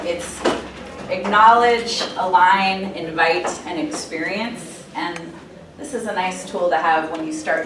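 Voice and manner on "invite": ordinary talk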